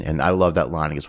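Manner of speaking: usual speaking voice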